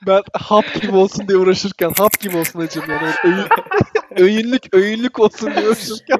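giggling through the words; words broken by laughter; laughing while talking. Real, not acted. Gülerek yakınma. (gülerek konuşma)

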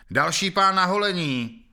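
high, loud, slow jeering shout. A narrator's reading. Another man in the crowd shouts, jeering at the prisoner. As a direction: yell it out across the crowd, mocking. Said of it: "vykřikl jiný hlas".

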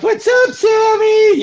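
high, slow sing-song greeting; lightly voiced street girl calling out; drawn-out high hello from the sidewalk; hookers greeting. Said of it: "they're like".

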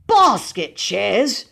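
loud, drawn-out incredulous outburst; outraged echo, swooping up at the end; violent explosion. A narrator's reading. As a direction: explode at the absurd excuse. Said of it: "he exploded violently".

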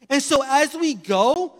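loud and drawn out, slowly; slow, weighty preaching. Acted. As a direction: lean hard on each word, slowly. Preaching on fear and love.

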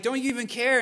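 rising to a loud, high, desperate plea; frightened disciples shouting at a sleeping Jesus; panicked disciples. Retold or imitated. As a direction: cry out over the storm as if about to drown. Retelling disciples in the storm.

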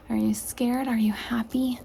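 usual speaking voice

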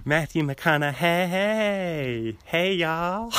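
very slow, stretched-out drawl impression; Matthew McConaughey. Fan playfully mimicking a favorite actor, long drawl. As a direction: draw out the vowels in a lazy Texan greeting. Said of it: "he kinda sounds like Matthew McConaughey".

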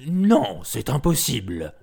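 loud, curt beastly refusal; tiger refusing. A narrator's reading. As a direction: bark a firm no. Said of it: "leur dit".

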